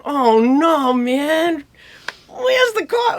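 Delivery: high-pitched mock worry; retold dismay. Musicians watching their lawyer risk the deal, mock dismay. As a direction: rise into a pleading wail of dismay.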